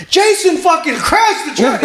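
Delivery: high-pitched mock scream, going crazy; retold screaming. Boss screaming the bad news into the phone, mock high-pitched yell. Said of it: "he just starts screaming and going crazy".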